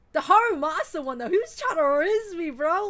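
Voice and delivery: loud, high, thrilled outburst; excited gush. Real, not acted. Streamer gushing to chat about a flirty character, loud and thrilled. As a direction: squeal it like he just flirted with you.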